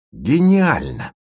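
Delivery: high, drawn-out delighted exclamation; excited exclamation. Acted. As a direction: exclaim it slowly, soaring in pitch. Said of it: "воскликнул Леденец". (восторженное восклицание)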